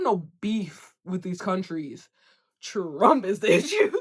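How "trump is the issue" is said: laughing. Real, not acted. Laughs while naming the issue.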